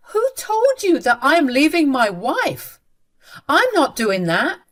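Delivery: acted outraged son; indignant denial. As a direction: challenge the claim angrily. Son indignantly denies leaving wife.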